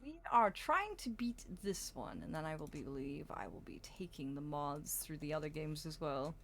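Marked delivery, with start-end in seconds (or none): 2.2-6.3 whispering. Real, not acted. Aside whispered.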